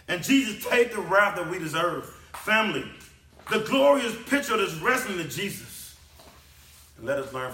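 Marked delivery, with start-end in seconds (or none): 0.1-6.0 high, loud sermon climax; impassioned preacher in full cry; full-force preaching. Real, not acted. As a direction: preach it loud, pitched well up.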